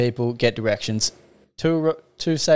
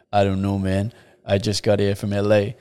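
a lower, Tyler-like low voice; really low voice; 4 semitones lower than usual. Retold or imitated. Tall stranger answering a lost tourist, low voice. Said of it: "that really low voice".

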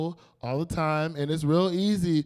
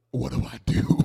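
stage-whispered mock panic; helpless whisper; 4 semitones lower than usual. Overslept and missed the bus, helpless stage whisper. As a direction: whisper it as a stranded teenager.

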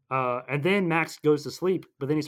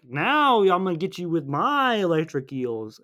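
slow, higher villain imitation; mock villain threat; 4 semitones higher, 4 dB louder, slower than usual. Recapping Mister Electric threatening the heroes, mock-menacing. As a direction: draw out the threat with hammed-up relish.

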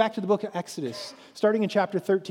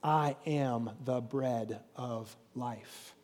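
very slow, low and soft; solemn declaration; 7 semitones lower, 9 dB quieter, much slower than usual. A narrator's reading. Jesus' declaration heard a second time, slow solemn reading. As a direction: declare it gravely, word by word. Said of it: "Jesus declared".

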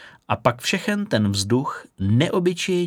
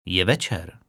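dry remark; 4 semitones lower than usual. A narrator's reading. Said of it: "upozornil ji suše".

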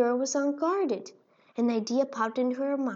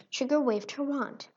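ordinary delivery, nothing marked

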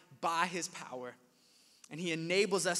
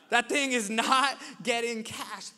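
louder and slower for emphasis; emphatic and slow; 5 semitones higher, 8 dB louder than usual. Acted. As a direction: land the punchline hard. Emphatic punchline about check.

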